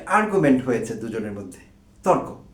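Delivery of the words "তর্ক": ordinary talk, nothing marked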